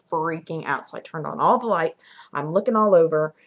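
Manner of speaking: ordinary delivery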